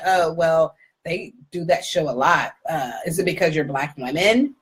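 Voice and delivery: low, slightly slow dismissive voice; imitating unimpressed acquaintance. Woman questioning the host about her show, low dismissive imitation.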